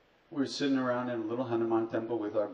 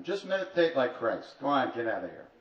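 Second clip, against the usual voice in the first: high, loud call after someone leaving; raised-voice parting order, imitated; retold yell; 3 semitones higher, 6 dB louder than usual. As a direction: yell it after him as an impatient guru. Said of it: "Maharaji yells after him".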